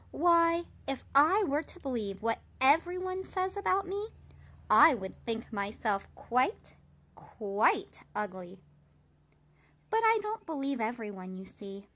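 put-on old spider cheerfully waving off gossip; amused old spider. A narrator's reading. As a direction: tease the idea out with a chuckle in it. Spider's lively louder speech.

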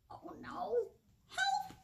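high, small cry for help; tiny mouse cry. Acted. Mouse girl stuck in skates crying for help, high and small. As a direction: squeak out for help.

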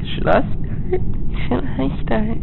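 high falsetto mimic of a girl asking; imitating a girl. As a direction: squeak it out like a girl player.

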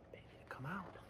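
an unvoiced whisper. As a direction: whisper it close so the egg won't hear. Whispered answer.

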